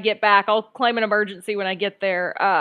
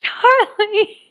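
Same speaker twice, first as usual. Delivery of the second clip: high exasperated call; 11 semitones higher than usual. Real, not acted. Calling out to friend.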